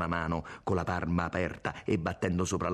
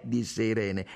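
ordinary delivery

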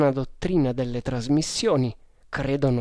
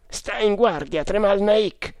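raised-pitch caution; urgent warning; 7 semitones higher than usual. A narrator's reading. One of the Tugs to his leader, urgent high caution. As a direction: warn him with pitch lifted.

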